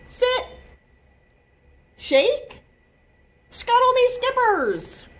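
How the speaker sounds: high, loud command voice; giving commands. Acted. Izzy teaching Oscar tricks, high bright commands. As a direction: train a pet with bright commands.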